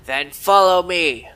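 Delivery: loud, slow order; loud slow summons; loud command. Acted. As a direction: call it out gruffly. Guard leads visitor on.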